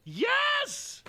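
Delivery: high excited cheer; real joy; triumphant. Real, not acted. Celebrating first alpha shiny.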